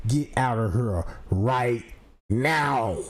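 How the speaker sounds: low, slow commanding cop; deep police voice. Retold or imitated. Chief ordering him out of his own house, low and slow. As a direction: order him out like a stern officer.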